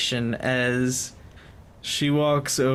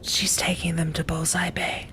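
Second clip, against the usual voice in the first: hushed reveal; 4 semitones higher than usual. Acted. Hushed reveal of where they went.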